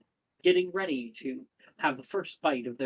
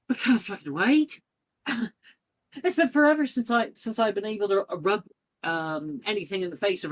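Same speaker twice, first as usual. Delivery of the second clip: high, excited student voice; eager excitement; 8 semitones higher, 3 dB louder, faster than usual. Acted. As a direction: burst out, thrilled and impatient. Beaming student eager for the match.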